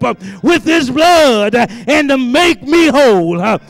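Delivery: loud rhythmic whooping preacher, gasped uh between phrases; preaching at full force. Acted. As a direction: build the sermon to a shouted climax. Whooped climax of sermon.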